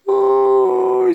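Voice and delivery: high, loud wail; mock crying wail. Grandma left crying after the horse flees, acted wail. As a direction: wail loudly like a crying granny. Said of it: "se quedó llorando la abuelita".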